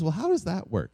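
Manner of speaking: a hint of a child's questioning voice; retold six-year-old. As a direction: lightly imitate a six-year-old wondering how. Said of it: "my six-year-old".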